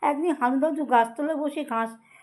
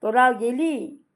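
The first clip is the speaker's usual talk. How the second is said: ordinary delivery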